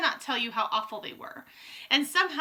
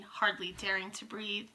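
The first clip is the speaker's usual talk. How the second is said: ordinary delivery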